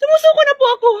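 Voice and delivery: high, loud plea that settles a little; pleading surrender. Acted. Player surrenders to the enemy in a war game, high frantic plea. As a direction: give up in a high-pitched, panicky plea.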